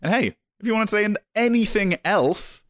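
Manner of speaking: high, bright announcer pitch; excited. Real, not acted. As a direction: sell it with a big upbeat lift. Enthusiastic outro plug.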